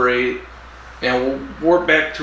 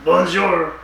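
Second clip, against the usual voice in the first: mock French accent; 3 semitones higher than usual. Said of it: "French makes perfect sense".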